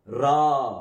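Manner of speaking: ரா held as a long aa; long vowel. Shown as a demonstration. Teacher showing the sound of resh with a vowel sign, long vowel. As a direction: draw out the vowel in ரா. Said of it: "நீண்ட துணி வரும்".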